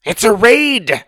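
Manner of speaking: high, loud, slow cry of alarm; dragon man alarm. Acted. Second dragon man crying out the raid, loud high alarm. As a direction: call out the raid. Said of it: "said another".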